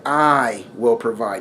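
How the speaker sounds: quiet, slow, serious promise. Retold or imitated. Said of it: "he was very serious about it".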